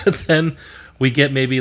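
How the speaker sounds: ordinary talk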